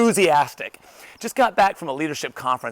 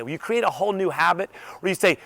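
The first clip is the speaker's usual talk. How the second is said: normal talking voice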